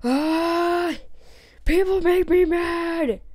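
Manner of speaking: put-on loud, high, angry drunk lumberjack; drunk Irish lumberjack. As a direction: shout it like an enraged Irish drunk. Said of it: "This is how an Irish lumberjack".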